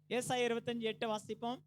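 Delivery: usual speaking voice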